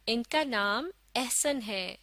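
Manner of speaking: ordinary talk, nothing marked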